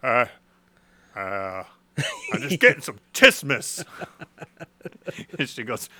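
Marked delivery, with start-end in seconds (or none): 0.1-3.8 sleepy nonsense voice. Retold or imitated. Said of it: "And I go". 3.9-5.3 breathy laugh. Real, not acted. Laugh after quote.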